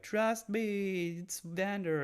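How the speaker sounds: high, drawn-out pleading quote; mock-earnest high voice; imitating Jinx. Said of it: "and Jinx like".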